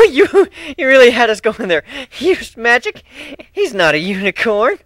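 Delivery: amused disbelief; laughing. Acted. Pony laughing at the cat's magic story.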